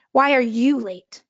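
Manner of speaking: "you" punched in "Why are you late?"; stress on YOU. Shown as a demonstration. Teacher demonstrating emphasis to class, stress on "you". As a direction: lean on "you", as if singling them out. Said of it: "by emphasizing different words".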